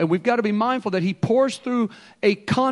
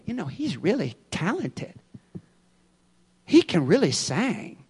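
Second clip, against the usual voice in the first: much quieter, hushed praise; admiring gossip; 7 dB quieter than usual. Retold or imitated. Preacher mimicking people admiring a talented singer, hushed. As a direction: murmur it like impressed churchgoers.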